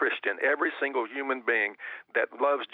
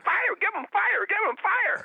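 high, frantic mimicked yelling; retold yelling. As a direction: imitate her urgent cries, pitched way up.

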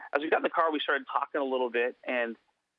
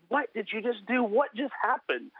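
amazed; 8 semitones higher than usual. Retold or imitated.

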